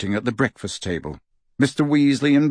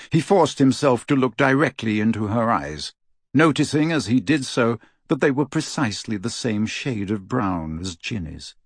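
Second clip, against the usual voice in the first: plain reading voice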